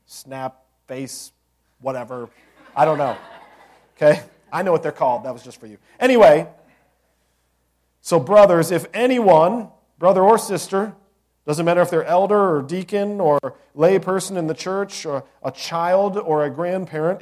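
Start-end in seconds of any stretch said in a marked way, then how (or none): 6.0-17.2 loud, rising pulpit call to the brothers; preaching at full force. Acted. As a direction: lift the whole room with it.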